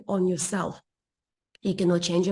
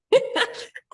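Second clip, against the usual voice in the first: high laugh. Real, not acted. Laughing at the memory.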